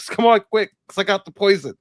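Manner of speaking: high, loud, frantic begging; panicky plea. Retold or imitated. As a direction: beg like you think you're poisoned. Retold panicked snakebite plea.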